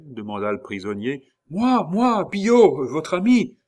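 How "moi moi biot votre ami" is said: very high, loud eager calls; excited shouting. A narrator's reading. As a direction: shout to be recognised. Rescuer shouting through cell door. (cris aigus et exaltés)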